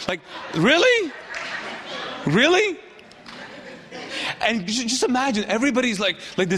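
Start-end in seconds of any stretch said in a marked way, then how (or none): 0.5-2.8 high-pitched, swooping disbelief; incredulous. Acted. Peter doubting Jesus's question, high incredulous voice. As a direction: query it in mock astonishment.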